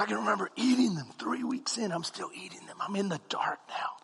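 soft unvoiced whisper; whispering in the dark; whispered confession. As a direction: hide it from the family. Secret midnight eating.

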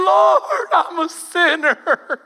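high, loud, hammed-up wail; mock a frightened little girl crying out. Teasing Peter about his fall to the ground, hammed-up high wail. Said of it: "looked like a little girl on the ground going".

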